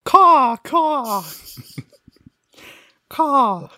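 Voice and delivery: high crow cawing; shrill screeching bird woman. Pretend. Charades, making bird noises.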